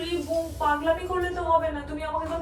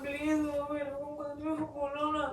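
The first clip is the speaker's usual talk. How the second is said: soft tearful begging; hushed, weeping plea; crying; 8 dB quieter than usual. Real, not acted. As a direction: beg quietly through tears. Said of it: "কান্না করো না".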